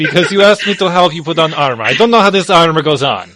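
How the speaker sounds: loud, flustered comeback; exasperated protest. Acted. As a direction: snap back in mock indignation. Acted quarrel over putting on armor.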